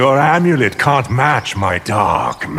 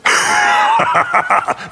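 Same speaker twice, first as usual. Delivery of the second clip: a sorcerer's gloating evil cackle; evil laugh. Acted. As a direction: laugh darkly at the foe's accusation. Villain sorcerer mocks his foe.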